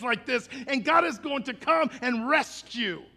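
high, loud, rising to shouted preaching; full-force preaching. Real, not acted. Preacher rallying church against despair, loud fiery preaching. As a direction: build from forceful challenge to defiant roar.

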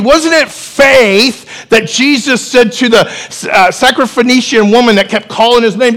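ordinary talk

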